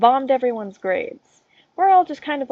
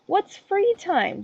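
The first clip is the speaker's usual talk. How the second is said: mock bewilderment; 12 semitones higher, much slower than usual. Mock confusion, slow high.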